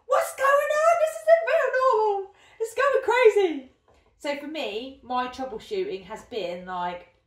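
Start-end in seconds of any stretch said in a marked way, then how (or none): 0.0-3.7 squeaky, high-pitched panic; panicked high voice. Retold or imitated. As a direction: shriek it in alarm. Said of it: "I'm like".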